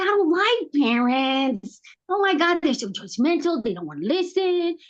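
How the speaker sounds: high, exasperated retold grumble; acted-out griping about parents; exasperated complaint. Replays her complaint about parents.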